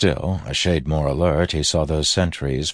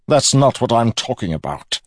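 loud, high villain's reply; projected retort; 7 semitones higher, 6 dB louder than usual. A narrator's reading. Villain corrects his nephew, loud and raised.

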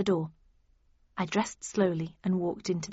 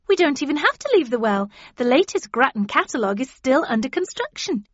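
higher, louder, excited pitch; eager persuasion; 6 semitones higher, 6 dB louder than usual. A narrator's reading. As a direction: talk them into it brightly and eagerly. Eager friend pressing her case.

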